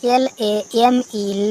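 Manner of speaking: letters ए एम ई spelled one by one, slowly; slow, deliberate letter-by-letter spelling. Shown as a demonstration. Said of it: "सेम means शर्म".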